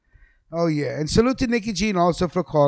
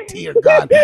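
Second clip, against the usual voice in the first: a high, loud "dear God" of mock dismay; exclaiming in dismay; 14 semitones higher, 3 dB louder than usual. Host realizing he stirred up the crowd, mock dismay. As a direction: cry out like you've just been caught. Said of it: "I said".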